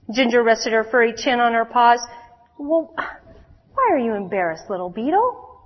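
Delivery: put-on lively storyteller voice for young listeners; children's story reading. A narrator's reading. Preacher reading a story to the children. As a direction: read it like a bedtime story to kids.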